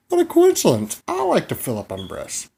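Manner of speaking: high, loud comic astonishment; hammed-up high surprise; mock amazement. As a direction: squeal it as if stunned by the coincidence. Feigned amazement in a comic bit.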